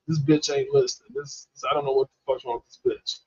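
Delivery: usual speaking voice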